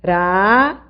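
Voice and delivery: Caráter split into ca, rá, ter, with RÁ held long; Caráter in three slow, separated beats; syllable by syllable. Shown as a demonstration. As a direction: sound out "Caráter" in isolated syllables, stretching rá. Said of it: "vou dividir as sílabas da palavra".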